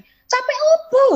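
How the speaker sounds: bentakan tinggi dan lambat; bentakan sinis. Retold or imitated. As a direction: ejek alasan capeknya. Leader membentak. (bos membentak sinis, suara melengking)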